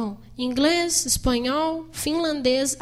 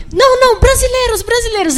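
high, loud joyful brasileiros; delighted welcome; 8 semitones higher, 8 dB louder than usual. Retold or imitated. Guard joyfully waving the Brazilians into the stadium. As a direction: wave them in with glee.

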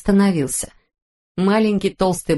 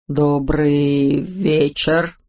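lightly lower father voice; 5 semitones lower, much slower than usual. Acted. Holmsa's father, deeper.

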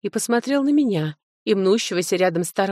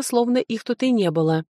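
ordinary delivery, nothing marked

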